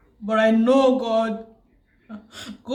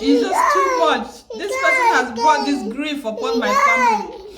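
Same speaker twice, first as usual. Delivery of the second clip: high, louder crying wail; sobbing outburst; 10 semitones higher, 3 dB louder than usual. Real, not acted. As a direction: break down into a loud sob over the loss. Grief over cousin's death breaks out.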